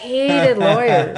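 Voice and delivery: high, slow, amused repeat through a laugh; chuckling emphasis on her dad's dislike of lawyers. Real, not acted. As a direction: laugh it out in disbelief.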